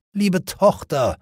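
slow, high groaning lament. Acted. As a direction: groan it like a worn-out father. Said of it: "stöhnte Leodegrans".

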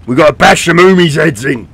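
loud hammed-up orc boss voice; orc commander. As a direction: growl out the orders like a cartoon orc. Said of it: "a voice pack for the orc commander".

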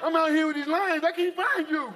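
high, gushing reunion voice, climbing as it goes; mock-emotional lion wailing to an old pal; overjoyed lion. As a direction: gush like a long-lost friend, hammed up. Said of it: "playing the lion like this".

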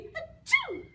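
high, loud mock sneeze; pretend sneeze. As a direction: burst out a big storybook achoo. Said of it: "He sneezes".

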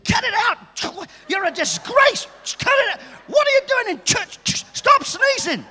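scolding mother. Retold or imitated. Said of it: "She'd say".

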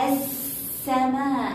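"السماء" slow as as-samaa, no L; silent lam. Shown as a demonstration. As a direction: hold a long doubled س in السماء. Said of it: "هل لفظنا اللام؟".

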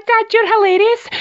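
usual speaking voice

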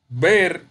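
"Ver" said alone with loud, full stress; stressed syllable VER. Shown as a demonstration. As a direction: punch out "Ver" as the strong syllable. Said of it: "es la sílaba tónica".

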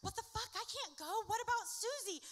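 high, loud alarmed outcry; panicked shout. Acted. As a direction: shout back in disbelief. Panicked reply to Ike.

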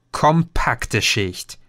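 slowly echoing the word; slow repeat. Acted. Repeats Green's term. (langsam, ungläubig nachgesprochen)

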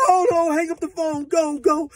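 imitating a frantic girlfriend; panicked hollering. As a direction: push him to go now. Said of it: "she crying and hollering".

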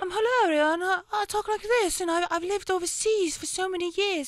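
very high, put-on vague European accent; mock a fake overseas accent; Hilaria. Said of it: "She just genuinely pretends to have an accent".

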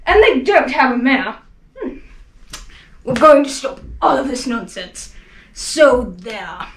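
jeering shout. Acted. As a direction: yell them down with scorn. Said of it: "came shouting".

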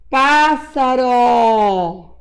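Pássaro very slow and loud, the first A wide open; open stressed A. Shown as a demonstration. Teacher showing children the missing accent, drawn-out open PÁ in Pássaro. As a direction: stretch "Pássaro" out, mouth wide on the PÁ. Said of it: "eu abri bem muito a minha boca".

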